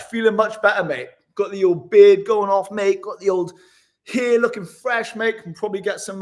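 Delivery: ordinary talk, nothing marked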